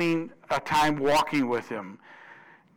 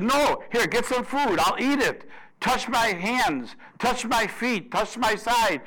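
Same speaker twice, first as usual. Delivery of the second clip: higher pleading urgency, insistent; eager urging; 6 semitones higher than usual. Retold or imitated. Risen Lord urging doubting disciples to touch him, eager and higher. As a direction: press them to touch and believe.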